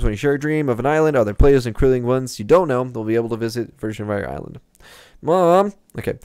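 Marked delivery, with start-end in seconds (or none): 5.2-5.7 louder, higher call to mom. Real, not acted. Gamer calling out to his mom mid-stream, loud. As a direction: yell across the house.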